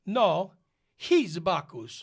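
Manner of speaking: put-on loud, emphatic Italian-accented correction. Italian at rehearsal corrects the newcomer, loud Italian accent. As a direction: contradict him firmly in an Italian accent.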